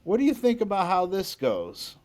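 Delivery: high-pitched imitation of a Texas drawl. Hero asks the bass player's opinion at sound check, imitated Texas drawl. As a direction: imitate a Texan, lifting the pitch well up. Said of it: "in his Texas drawl".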